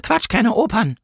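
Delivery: high-pitched, impatient retort; dismissive policewoman. A narrator's reading. Policewoman rejects the angel's excuse, high and impatient. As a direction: snub it in a high voice. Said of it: "sagte die Polizistin".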